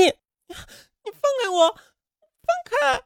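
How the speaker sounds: very high, slow, strangled crying plea; choked cry. A narrator's reading. Strangled friend begs to be let go, high choked crying. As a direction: gasp for release while being choked.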